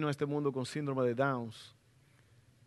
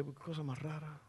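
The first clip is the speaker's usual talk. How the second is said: thinking aloud; 12 dB quieter than usual. Retold or imitated. Y yo decía.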